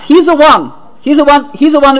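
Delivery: high, insistent retold shout. Woman recognizing her rescuer on entry, shouting out. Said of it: "she shouted out".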